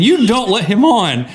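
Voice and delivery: usual speaking voice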